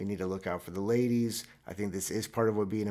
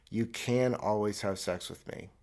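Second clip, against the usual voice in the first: drawn out, mock seductive; slow smug deadpan pitch; slow deadpan offer.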